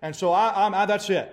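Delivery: high, loud, furious tirade; raging complaint. Retold or imitated. Naaman storming off from Elisha's servant, raging. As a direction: rant as if insulted. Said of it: "went off in a rage".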